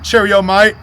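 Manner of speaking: high, loud mock London accent; reluctant stab at a London accent; badly faked London accent. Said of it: "Let's hear you do a London accent".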